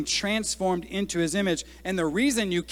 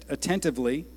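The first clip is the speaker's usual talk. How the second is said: normal talking voice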